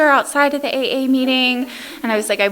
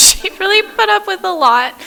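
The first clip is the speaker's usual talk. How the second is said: laughing; 11 semitones higher, 3 dB louder than usual. Real, not acted. Laughing about stepmom's patience.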